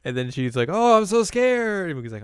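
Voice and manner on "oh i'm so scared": high, loud, slow feigned fright; mock scared. Azusa humouring Moogie's failed scare, feigning fright. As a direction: play along with exaggerated fear.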